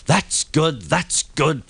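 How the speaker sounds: lightly voiced engine puffing with relief; a hint of a relieved little engine; puffed relief. A narrator's reading. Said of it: "puffed Thomas".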